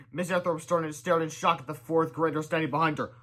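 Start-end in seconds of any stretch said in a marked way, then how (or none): none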